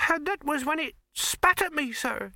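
put-on high-pitched young servant's complaint; aggrieved maid. A narrator's reading. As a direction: finish the report in a high, put-upon voice. Maid complains to her betters.

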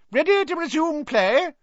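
a no-nonsense referee checking in; brisk referee. A narrator's reading. Madam Hooch, the referee.

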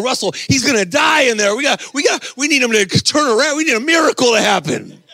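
put-on high, urgent kid in a fluster; mock panic. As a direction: play up a young fan's desperate worry. Acts excited boys watching the match.

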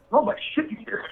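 disgusted. Retold or imitated. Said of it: "exactly the way he said it".

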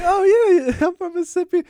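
loud, high mock Mississippi accent; hammed-up Mississippi accent, laughing. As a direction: imitate an excited Mississippi talker. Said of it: "the Mississippi gets me".

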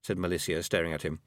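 plain reading voice

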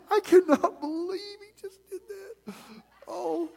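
high-pitched, giggly mock disbelief; mock giggling. Disciples in the back reacting to the spit, mock giggling. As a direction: imitate a scandalised giggler, high-voiced. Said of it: "like, giggling".

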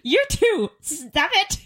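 high-pitched, exasperated telling-off; playful high-pitched protest; exasperated retort. Retold or imitated. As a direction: tell off two teasers, voice shooting up. Acted retort to teasing relatives.

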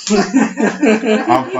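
very slow, high laugh; slow laughing fear. Retold or imitated. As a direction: drag it out laughing. Scared in the tent.

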